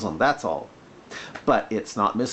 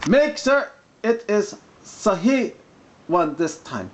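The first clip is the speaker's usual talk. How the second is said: higher, slow put-on Arabic accent; mock Arabic accent; 8 semitones higher, slower than usual. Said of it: "when I'm doing the mock Arabic accent".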